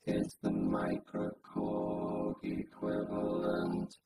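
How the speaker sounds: very slow, very quiet synth-robot speech; chorused vocoder voice. Shown as a demonstration. Reviewer testing vocoder with chorus, slow quiet robotic voice. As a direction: intone slowly and softly through a vocoder. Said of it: "listen to it with some chorus".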